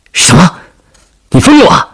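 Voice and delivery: high, startled cry of disbelief; alarmed outburst. Acted. Veteran hearing the princess's plan, shocked outburst. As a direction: blurt it out, pitch shooting up. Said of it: "露出了惊恐和不安的神色， 话从嘴里挡不住的喊出".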